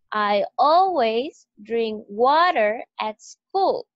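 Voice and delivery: always, drink, water and school hit hard and slow; slow, loud, every key word punched; slow stressed model. Shown as a demonstration. Teacher models the sentence for students to repeat, slow and stressed. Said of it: "repitan conmigo".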